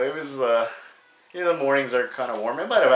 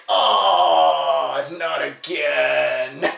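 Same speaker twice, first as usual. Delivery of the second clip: pained groan; much slower than usual. Acted. Friend hitting his back on the car, pained groan. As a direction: groan it out slowly in mock agony.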